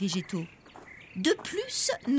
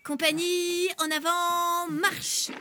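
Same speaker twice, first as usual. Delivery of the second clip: high military cadence calls; marching orders; 5 semitones higher, slower than usual. Acted. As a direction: call the march step. Colonel fait marcher la troupe. (ordres de marche)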